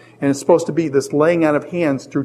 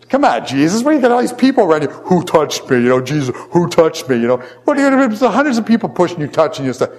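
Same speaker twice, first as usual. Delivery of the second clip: higher, fast, mock-exasperated protest; exasperated disciples; 3 semitones higher than usual. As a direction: play the baffled disciples pushing back. Disciples exasperated at Jesus' question.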